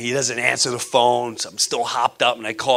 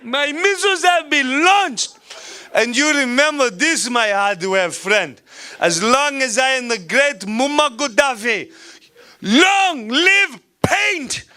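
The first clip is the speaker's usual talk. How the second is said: over-the-top Gaddafi. Pretend. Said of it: "I'm this over the top".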